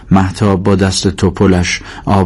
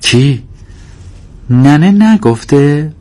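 higher-pitched, drawn-out curious question; intrigued and incredulous; eager questioning; 8 semitones higher, much slower than usual. Acted. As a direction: demand the news, stretching the words. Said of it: "هر دو با هم پرسیدن".

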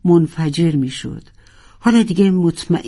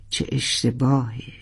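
regretful sigh; 4 semitones lower, 6 dB quieter than usual. Acted. Regretful repeated lament.

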